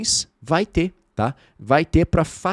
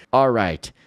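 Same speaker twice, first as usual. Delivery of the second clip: ordinary delivery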